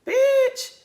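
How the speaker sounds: high-pitched, drawn-out playful call; exaggerated squeal-high catchphrase; playful exclamation. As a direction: sing it out way up high. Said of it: "I'll be like".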